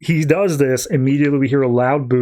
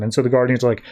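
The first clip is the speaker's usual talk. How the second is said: normal talking voice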